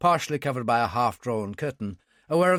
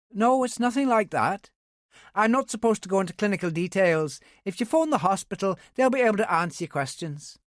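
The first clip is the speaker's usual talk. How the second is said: higher, brisk Belfast sergeant on the phone; young Ulster policewoman; 7 semitones higher than usual. A narrator's reading. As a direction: voice a young Northern Irish policewoman.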